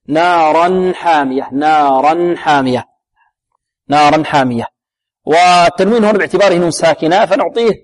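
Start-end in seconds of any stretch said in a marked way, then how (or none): 0.1-4.7 نارا حامية with the tanween on نارا sounded clearly as naran, slow. Shown as a demonstration. Teacher showing students the clear tanween in نارا حامية. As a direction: recite نارا حامية, making the nun of naran distinct. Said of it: "هنا لابد من إظهار التنوين".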